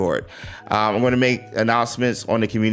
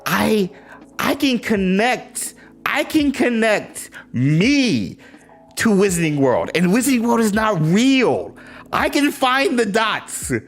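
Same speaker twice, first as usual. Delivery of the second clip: eager pleading; 8 semitones higher than usual. Real, not acted. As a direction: sell yourself to them, earnestly. Begging devs to hire him.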